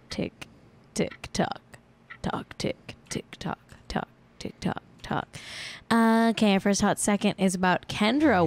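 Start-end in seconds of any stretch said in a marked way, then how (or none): 0.1-5.3 soft whispered tick tock; ticking clock. Pretend. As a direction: tick like a clock, almost silent. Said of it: "segment I like to call Hot Seconds".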